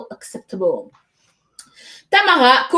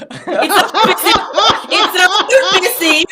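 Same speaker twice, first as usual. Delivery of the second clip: high, louder, laughing through the words; laughing protest; 6 semitones higher, 4 dB louder than usual. Real, not acted. Host joking with a guest about helmets, real laughing protest. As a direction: crack up while trying to explain.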